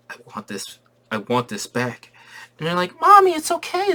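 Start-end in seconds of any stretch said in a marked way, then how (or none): 0.1-2.1 breathy hushed longing, slowing down; quivering whisper. Retold or imitated. Wanda to the drawing, hushed and quivering. 3.0-3.9 put-on squeaky high little kids calling Mommy; kid voices. Kids begging their mom to come see.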